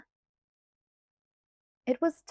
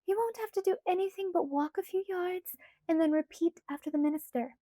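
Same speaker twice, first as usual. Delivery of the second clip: put-on light, higher girlish pleading voice; Alice's voice; 8 semitones higher than usual. A narrator's reading. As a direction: voice Alice higher, coaxing Bella. Alice reassuring.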